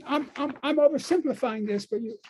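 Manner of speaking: usual speaking voice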